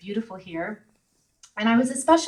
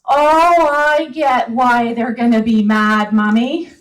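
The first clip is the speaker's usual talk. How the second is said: put-on child's voice; 3 semitones higher, 13 dB louder, slower than usual. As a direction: play her seven-year-old son explaining. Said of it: "he says".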